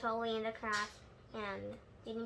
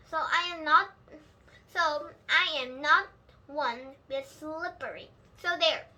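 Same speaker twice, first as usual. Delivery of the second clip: put-on higher, louder stubborn kid protest; indignant little girl; 3 semitones higher, 7 dB louder than usual. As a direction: stamp your foot in words. Child retorts to parents.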